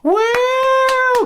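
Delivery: put-on high, loud girlish "woo"; imitated whoop from the crowd; mock crowd cheer. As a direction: squeal like an overexcited concert fan. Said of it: "some stupid girls in the crowd like".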